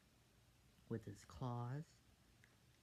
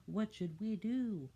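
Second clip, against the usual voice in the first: put-on child voice; 8 semitones higher, 6 dB louder than usual. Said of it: "one of the other ones say".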